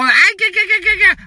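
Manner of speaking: high mock machine babble; mock the phone system's noise; mocking hold babble. Mocking the phone line's hold noise, high. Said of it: "You can listen to all".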